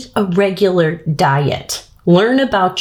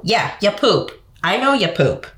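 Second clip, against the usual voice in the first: usual speaking voice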